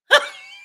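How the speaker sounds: loud short burst of laughter; sudden laugh. Real, not acted. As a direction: crack up at your own dig. Cracks up at own mean comparison.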